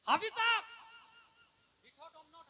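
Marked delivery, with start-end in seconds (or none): 0.1-0.6 loud, high hail; raised, ringing address; calling out. Retold or imitated. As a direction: call out the title to someone across a crowd. Calling out a respectful title.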